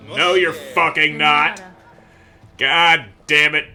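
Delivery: annoyed. Real, not acted. Caravan not ready again.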